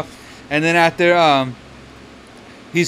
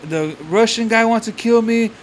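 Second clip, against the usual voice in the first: higher-pitched imitation of a distraught man; lightly acted alarm; retold frantic complaint; 7 semitones higher than usual. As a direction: mimic his paranoid pleading, pitched up. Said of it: "he's fucking spazzing out".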